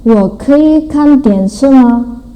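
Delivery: "Wo kei kan dianshi ma" slow and careful; Mandarin example sentence. Shown as a demonstration. Teacher reads a Mandarin example to students, slowly.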